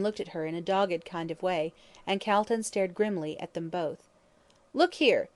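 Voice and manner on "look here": higher and louder, an angry outburst. Acted. Lawyer scolding Brian for his conduct, angry. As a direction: bark it at him in anger. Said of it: "in an angry voice".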